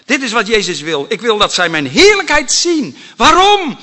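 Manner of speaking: loud, high preaching climax; preaching at full force. Real, not acted. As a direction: lift the voice to the peak. (luid en hoog preken)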